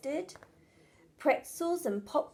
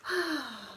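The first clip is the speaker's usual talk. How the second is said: sigh; 5 dB quieter than usual. Acted. Mum sees the mess.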